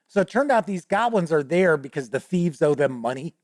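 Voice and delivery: usual speaking voice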